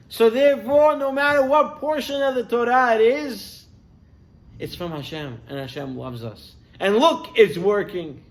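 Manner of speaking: rising high and loud with conviction; passionate, certain, then softening; fervent faith. Acted. Wife tells husband Hashem's Torah is sweet, fervent.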